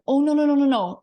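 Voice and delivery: loud, high rush of no's; retold panic as the truck cuts in; frantic mock denial; alarmed protest. Truck cuts in front of her.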